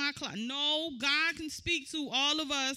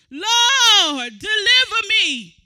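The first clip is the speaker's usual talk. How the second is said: high, much louder crying shout; desperate cry to the Lord; 7 semitones higher, 15 dB louder, much slower than usual. Retold or imitated. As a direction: cry out in desperate begging, pitched up. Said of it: "I'm under the dirt crying, begging and pleading".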